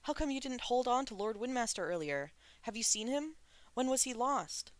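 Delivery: high, urgent, worried questions; anxious questioning. A narrator's reading. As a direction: press him for answers, worried. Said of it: "Shulian asked anxiously".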